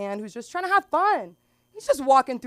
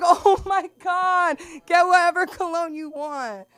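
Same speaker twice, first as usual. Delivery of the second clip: mock amazed; 9 semitones higher, 7 dB louder, slower than usual. Amazed retort.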